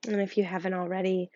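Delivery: hushed breathing guide; guided meditation. Acted. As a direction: coax them to listen to their breath.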